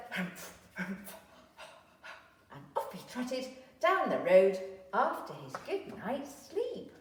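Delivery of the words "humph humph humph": soft breathy huffing; grumpy humph. Acted. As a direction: huff awake, grumpy. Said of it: "Dog woke up with a".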